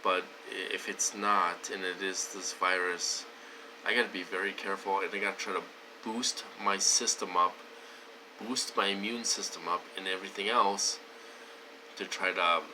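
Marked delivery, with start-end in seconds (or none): none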